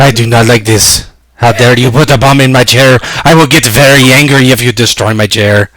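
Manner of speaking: mock-indignant Hungarian accent; comic Hungarian-accented protest. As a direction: scold in a put-on Hungarian accent. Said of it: "That's my Hungarian accent".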